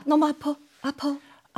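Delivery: soft whimpering over nothing; whiny fake pain. Shown as a demonstration. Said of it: "엄살이죠". (엄살 부리는 목소리)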